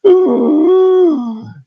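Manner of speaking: sharp high gasp of shock; loud, high startled gasp. Retold or imitated. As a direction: gasp as if a scream just pierced your ear. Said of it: "you just think".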